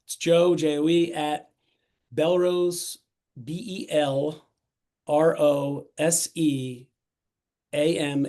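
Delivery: spelled slowly, letter by letter; slow spelling out. Real, not acted. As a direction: take it slow, pausing between each group of letters. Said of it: "I'll say it slow and spell it".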